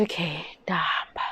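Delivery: a whispered, resigned okay. Retold or imitated. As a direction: breathe it out in a whisper, giving up. Retold resigned reply, whispered.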